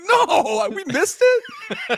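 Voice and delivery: high, slow, laughing wail; laughing despair. Retold or imitated. Fan realizing he missed the game, slow laughing wail. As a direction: wail the loss while laughing at yourself. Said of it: "I was like".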